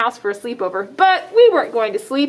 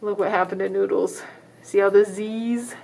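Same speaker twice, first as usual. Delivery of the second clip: ordinary delivery, nothing marked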